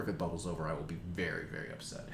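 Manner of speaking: dropping soft into a whisper; fading to a whisper. Real, not acted. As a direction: trail off to a whisper.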